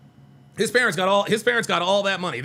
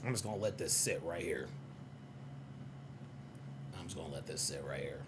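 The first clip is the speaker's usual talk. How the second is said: quiet mutter; 18 dB quieter than usual. Real, not acted. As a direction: murmur under breath. Muttering while showing.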